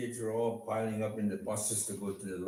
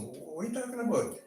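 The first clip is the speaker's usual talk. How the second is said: bewildered; 8 semitones higher than usual. Retold or imitated. Joe confused by Fred's words.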